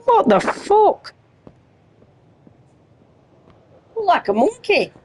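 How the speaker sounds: high, startled and incredulous; shocked disbelief. Real, not acted. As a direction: react like something bizarre just ran past. Reacting to a weird figure.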